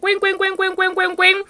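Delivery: high, ringing coin-payout noise; slot machine imitation. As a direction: rattle it off like a jackpot paying out. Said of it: "That's the sound of coins coming out of a slot machine".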